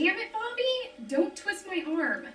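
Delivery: fed up, bite it out slowly; angry outburst. Acted. Girlfriend snaps at persistent ex.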